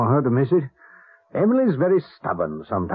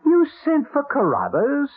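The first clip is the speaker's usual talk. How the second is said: outraged surprise; 9 semitones higher than usual. Acted. Husband outraged about the doctor.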